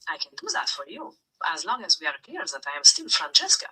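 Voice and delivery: ordinary delivery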